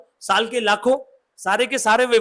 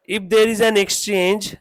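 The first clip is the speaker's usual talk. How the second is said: very slow, word by word for writing down; slow dictation; much slower than usual. Shown as a demonstration. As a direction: dictate it slowly. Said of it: "लिखो".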